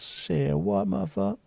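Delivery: high put-on toddler voice; mock baby voice. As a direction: talk like a tiny child. Imitating toddler self.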